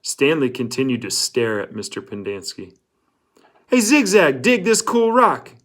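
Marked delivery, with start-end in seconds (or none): 3.7-5.5 put-on high, loud teen boy voice; excited boy showing off a rock; Magnet. A narrator's reading. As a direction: call it out high and eager. Magnet voiced in read story.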